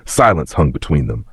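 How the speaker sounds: ordinary delivery, nothing marked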